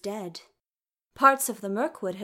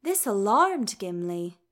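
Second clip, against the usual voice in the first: ordinary delivery, nothing marked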